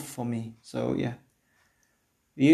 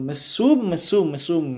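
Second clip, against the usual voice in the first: higher, louder disgusted outburst; outraged; 4 semitones higher, 5 dB louder, slower than usual. Real, not acted. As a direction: spit out the word in disgust. Outraged at the employee.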